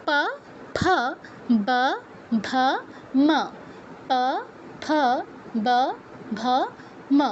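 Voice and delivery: প ফ ব ভ ম isolated, made with both lips; labial letter drill. Shown as a demonstration. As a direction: close and open the lips for each of প ফ ব ভ ম. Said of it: "ওষ্ঠ আর অধর মিলে". (প বর্গ, দুই ঠোঁটে থেমে থেমে)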